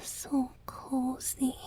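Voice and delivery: drowsy, slow, content whisper; sleepy whisper of comfort. Acted. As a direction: sink into comfort, whispering slowly. Resting his head in their lap.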